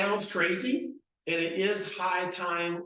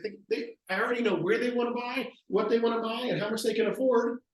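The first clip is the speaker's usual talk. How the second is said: higher, breezy self-assured tone; confident explaining; 6 semitones higher than usual. Retold or imitated. Young agent boasting he knows their budget, breezy. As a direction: make it sound easy and obvious. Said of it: "He's like".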